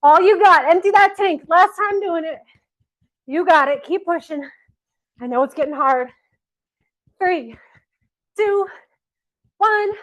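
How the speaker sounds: high, loud all-out urging; rally them to empty the tank; coach's shout. Real, not acted. Coach driving the class through the last climb, shouting.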